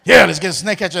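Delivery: loud, eager call for help; loud, hammed-up panic; mock eager shout. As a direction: blurt it out like a scared man passing the job on. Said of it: "he's like".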